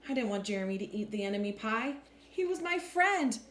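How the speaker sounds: ordinary delivery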